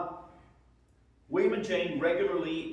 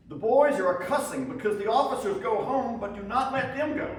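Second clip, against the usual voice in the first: higher, louder, earnest soldier writing home; soldier's letter; 9 semitones higher, 7 dB louder than usual. Acted. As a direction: read it as a homesick Union private. Reads soldier husband's letters aloud.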